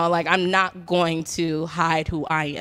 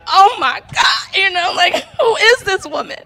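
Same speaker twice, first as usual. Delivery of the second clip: high, crying loud oh my God; overwhelmed crying exclamation; 13 semitones higher, 7 dB louder than usual. Real, not acted. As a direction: burst out overwhelmed. Overwhelmed by mom's acceptance.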